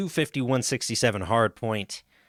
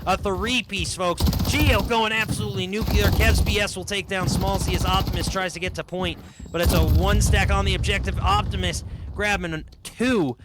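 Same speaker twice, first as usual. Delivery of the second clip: high-energy, raised-pitch esports commentary; auctioneer-like play-by-play; 6 semitones higher, 4 dB louder than usual. Real, not acted. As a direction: rattle off the live action with driving excitement. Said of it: "an auctioneer in that one".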